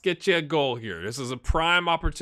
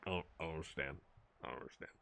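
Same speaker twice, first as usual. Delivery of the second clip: much softer, lower trailing mumble; baffled fading; 11 semitones lower, 18 dB quieter, much faster than usual. Real, not acted. Streamer giving up on explaining his player, softer baffled trailing off. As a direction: let the confusion trail off into nothing.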